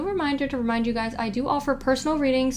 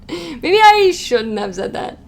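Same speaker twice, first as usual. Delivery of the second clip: slow, louder, higher mock regret; hammed-up second thoughts; 6 semitones higher, 7 dB louder, slower than usual. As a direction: draw it out in exaggerated, amused realisation. Repeats the regret, hamming it up, laughing.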